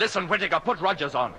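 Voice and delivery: high, insistent command; urgent order. Acted. Captain ordering crewman to fetch Rogers, urgent. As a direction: demand the other man now.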